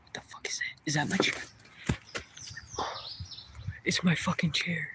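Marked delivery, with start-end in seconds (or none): 0.0-0.9 hushed, breathless whisper; real shocked whisper in the dark; startled whisper. Real, not acted. Startled by noise in woods. 2.4-3.8 soft startled intake of breath; real hushed gasp; sharp gasp. Real, not acted. Finds chair thrown over.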